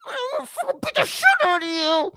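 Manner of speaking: very high, fast imitation of enraged spluttering; mock tantrum. Commentator mimicking the woman's threat, high-pitched mock rage. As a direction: spew the words out like a raging five-year-old. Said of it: "spew their words out like a fucking five-year-old".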